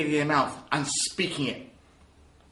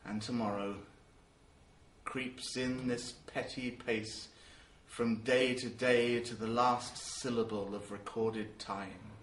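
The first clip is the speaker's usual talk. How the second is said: slow, much lower and softer, sinking to a hush; depressed and depressing; 6 semitones lower, 6 dB quieter, slower than usual. Acted. Macbeth realizing at the end that life is pointless, depressed. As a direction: let each tomorrow sink lower and heavier. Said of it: "sound fairly depressed and depressing".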